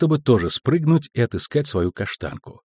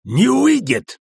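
very high, loud angry bark; barked shout; 14 semitones higher, 5 dB louder, slower than usual. A narrator's reading. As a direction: explode in a flat-out refusal. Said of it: "рявкнул Ярпин". (рявкает громко, на высокой ноте)

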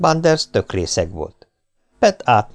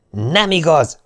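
sharp, higher retort in a moderate yell; shouting; 7 semitones higher, slower than usual. A narrator's reading. Pet shouts back at the girl, moderate yell. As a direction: throw it back at her as a denial. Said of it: "ordított fel Pet".

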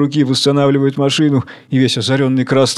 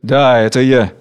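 dry displeased reply. Acted. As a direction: answer curtly, annoyed. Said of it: "сухой недовольный ответ". (сухо и недовольно)

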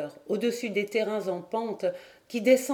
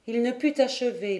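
plain reading voice

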